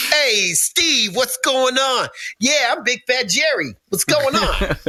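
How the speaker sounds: Big Fat Jerry. Retold or imitated. Coworker Jerry greeting Steve at work, high and jolly. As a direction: greet him like a big cheerful buddy. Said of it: "His name was Big Fat Jerry".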